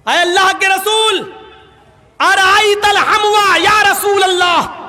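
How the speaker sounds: high, sustained, half-chanted sermon delivery; ringing preacher's recitation with drawn-out endings; impassioned preaching. Acted. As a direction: intone the hadith slowly and forcefully.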